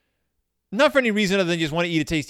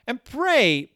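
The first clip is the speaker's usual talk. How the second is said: ordinary talk, nothing marked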